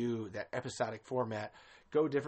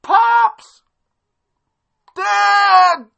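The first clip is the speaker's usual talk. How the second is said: much higher, much louder hammed-up cry for dad; frantic shouting; 19 dB louder, much slower than usual. Imagined dub of son searching for his dad, hammed-up frantic shout. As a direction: wail for a lost father, over the top. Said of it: "I just imagine".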